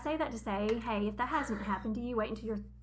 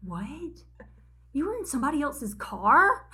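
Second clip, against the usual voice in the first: son's disbelief; 4 semitones higher, 7 dB louder than usual. Retold or imitated. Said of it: "he goes".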